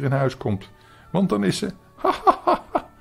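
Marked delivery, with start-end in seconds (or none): 2.0-2.9 high, loud ha-ha laughter; silly laughing. A narrator's reading. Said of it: "zat nu zo dwaas te lachen".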